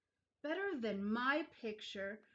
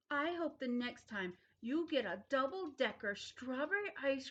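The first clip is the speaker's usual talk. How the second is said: spiteful; 4 semitones higher than usual. Acted. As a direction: hurl the curse at him. Child's angry wish at Paul.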